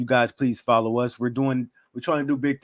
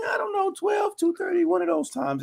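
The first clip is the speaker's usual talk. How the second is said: high unsure voice. Real, not acted. Unsure of the live stream times.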